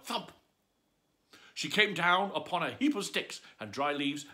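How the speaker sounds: plain reading voice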